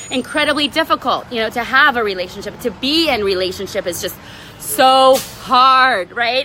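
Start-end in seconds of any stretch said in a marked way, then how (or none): 4.6-6.2 mock complaint. Mocking the common belief.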